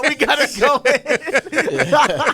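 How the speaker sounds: high, loud, drawn out through laughter; cracking up while imitating a game character; hammed-up, slow, laughing battle call; laughing mock quote.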